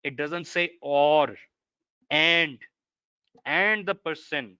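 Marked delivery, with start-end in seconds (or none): 2.1-2.6 and said louder, raised pitch; stress on and. Shown as a demonstration. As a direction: lean hard on the word and. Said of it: "look at the word".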